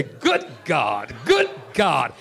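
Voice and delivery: drawn out preacher call. Shown as a demonstration. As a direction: say it like a preacher would. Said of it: "Say it like you're a preacher".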